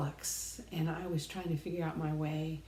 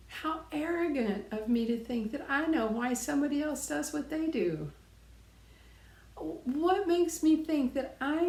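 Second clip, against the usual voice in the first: high, intense self-rebuke; rising indignation; 7 semitones higher, 4 dB louder than usual. Real, not acted. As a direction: scold yourself with rising pitch.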